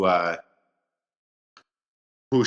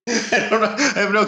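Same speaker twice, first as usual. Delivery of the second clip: laughing; 12 semitones higher, 3 dB louder than usual. Real, not acted. Laughing at own creepy voice.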